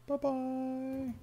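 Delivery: very high, soft singsong farewell; drawn-out falsetto bye-bye; playful goodbye. Pretend. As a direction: sing it out softly in a tiny high voice. Playful sign-off to the viewers.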